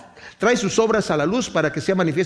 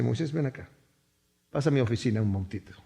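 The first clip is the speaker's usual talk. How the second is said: lower, softer and fast, a quiet word on the side; calm summons; 7 semitones lower, 7 dB quieter, faster than usual. Acted. God quietly calling Moses into his office after the rock, low and soft. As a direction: call him in quietly, no fuss.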